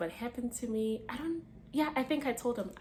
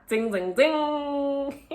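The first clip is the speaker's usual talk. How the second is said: chime imitation; 7 semitones higher, 7 dB louder, much slower than usual. Mimicking notification chime.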